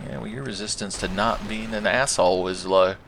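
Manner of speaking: ordinary talk, nothing marked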